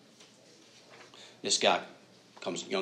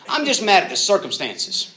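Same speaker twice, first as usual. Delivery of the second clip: much higher, louder indignant cry; indignant outburst; 9 semitones higher, 9 dB louder than usual. Retold or imitated. Frustrated student venting at the pastor, loud and indignant. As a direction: blurt it out, fed up.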